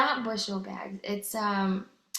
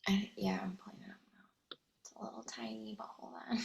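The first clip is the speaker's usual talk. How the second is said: hushed whisper while searching; real soft whisper; 13 dB quieter, faster than usual. Real, not acted. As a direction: murmur it under breath. Whispering while pulling up text.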